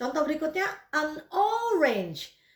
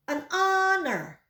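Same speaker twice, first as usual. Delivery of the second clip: an honor said slowly, "an anor" with no h; slow model pronunciation; 5 semitones higher, slower than usual. Shown as a demonstration. Teacher slowly models an honor for the class. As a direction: draw out an honor, dropping the H. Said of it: "Mari ikuti saya".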